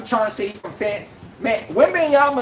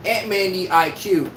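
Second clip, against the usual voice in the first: ordinary delivery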